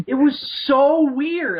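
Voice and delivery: high, loud and slow disbelief; baffled. Real, not acted. Telling cohost about the ticket, slow high disbelief. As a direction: stretch out how strange it was.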